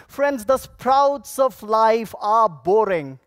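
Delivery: ordinary talk, nothing marked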